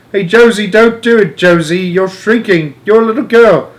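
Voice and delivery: high, urgent shouts; alarmed shouting. A narrator's reading. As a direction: shout for help in a panic. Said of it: "shouted Grandpa Joe".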